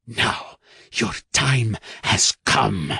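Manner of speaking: slow, hushed villain threat; half-whispered menace, very slow; menacing whisper. Acted. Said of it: "she whispered".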